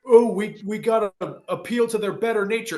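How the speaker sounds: high-pitched mocking do-gooder; mock wishy-washy. Speaker mocking those who would appeal to Nazis' better nature, wishy-washy. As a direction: pitch it way up, parodying a feeble appeaser. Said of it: "wishy-washy".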